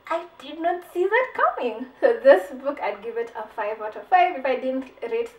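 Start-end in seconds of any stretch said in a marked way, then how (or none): 0.1-1.9 mock stunned amazement. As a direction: say it again, more amazed. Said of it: "you'll just be like".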